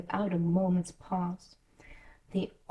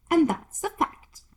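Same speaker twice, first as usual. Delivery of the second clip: rude Duchess; 10 semitones higher, 4 dB louder than usual. Acted. Duchess scoffing at Alice, high and loud. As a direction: sneer it down at her.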